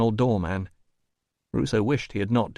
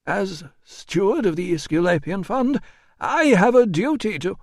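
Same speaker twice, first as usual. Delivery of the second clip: pompous administrator; 9 semitones higher, 4 dB louder than usual. A narrator's reading. Administrator citing his office, high and pompous. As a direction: recite your title grandly, pitched up.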